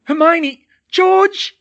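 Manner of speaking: high, very loud screams of protest; dragged away, crying out to friends in panic; desperate cry. Acted. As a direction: scream for them to stop. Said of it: "she cried".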